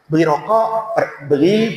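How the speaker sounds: ordinary talk, nothing marked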